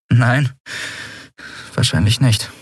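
usual speaking voice

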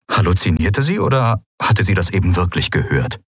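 ordinary delivery

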